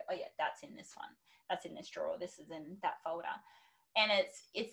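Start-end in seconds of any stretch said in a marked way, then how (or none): 0.1-3.3 quietly find each thing, untroubled; hushed, easy reassurance to oneself; calm. Acted. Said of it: "calmly go".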